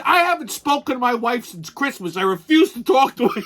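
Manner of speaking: lightly voiced petulant husband; distraught buddy. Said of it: "He said".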